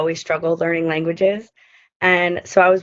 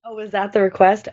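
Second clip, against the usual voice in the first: ordinary talk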